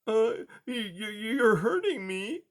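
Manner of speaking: high, pained stammering mouse; frightened little squeal. A narrator's reading. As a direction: whine it out in a small high voice. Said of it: "Blinker squealed".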